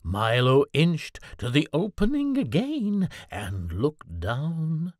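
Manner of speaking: ordinary delivery, nothing marked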